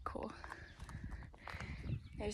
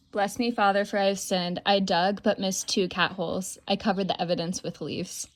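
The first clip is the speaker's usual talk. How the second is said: loud, lively read-aloud of a log entry; 6 dB louder than usual. Retold or imitated. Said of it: "reading the logbook".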